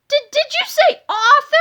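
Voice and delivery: high, loud, stammering excitement; excited cry. A narrator's reading. Boy hears he is an author, crying out excitedly. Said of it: "cried George excitedly".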